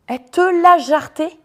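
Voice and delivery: high, loud, slowed emphatic retelling; excited. Real, not acted. Vlogger proudly telling how her friend threw him out. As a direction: relish it, she kicked him out.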